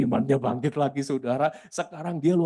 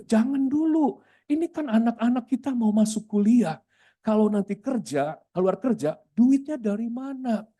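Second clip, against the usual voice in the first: high-pitched retold remonstrance; pitched-up protest, acted out; alarmed objection; 8 semitones higher than usual. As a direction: talk the worker out of it, urgently. Pastor retells his objection to worker.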